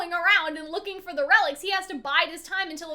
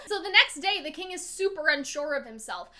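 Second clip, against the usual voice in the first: ordinary talk, nothing marked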